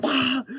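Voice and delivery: shrill charging yell. Retold or imitated. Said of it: "He's like".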